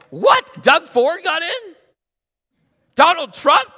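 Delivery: squeaky high, loud mock shock; shocked God. Preacher mocking a startled God over election news. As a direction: play God as caught totally off guard.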